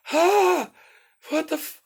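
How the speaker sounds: high, loud panicked shout; startled yell. Retold or imitated. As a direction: yelp in alarm. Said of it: "I'm like".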